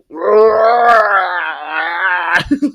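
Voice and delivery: long, high imitated bellow; mock moronic roar. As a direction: let out a drawn-out, exaggerated dopey yell. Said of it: "they're screaming and yelling, and you're like".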